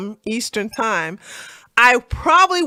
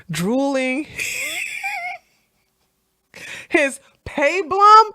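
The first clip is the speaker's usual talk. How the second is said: laughing; 9 semitones higher, slower than usual. Real, not acted. Laughing mid-read.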